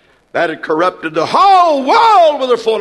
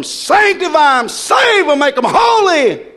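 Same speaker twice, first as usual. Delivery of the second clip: ecstatic prayer; 6 semitones higher than usual. Real, not acted.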